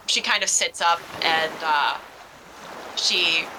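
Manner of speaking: ordinary delivery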